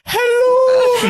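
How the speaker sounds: very high, loud, drawn-out greeting; Missus Doubtfire. Pretend. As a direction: trill it like a hammed-up Scottish nanny. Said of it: "gave me vibes of Missus Doubtfire".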